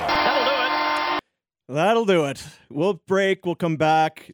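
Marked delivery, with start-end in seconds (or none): 0.1-1.7 slowly drawn-out host sign-off; slow drawn-out call. Real, not acted. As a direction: stretch every word long. Host drawn-out shout over music.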